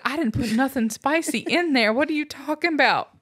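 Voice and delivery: high, incredulous protest; indignant. Retold or imitated. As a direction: protest in disbelief. Indignant reply to mom.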